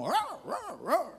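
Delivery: high-pitched hound baying; imitated dog barking. Bloodhounds that treed something, imitated barking. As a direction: bark like a pack of excited hounds. Imitates the dogs around the tree.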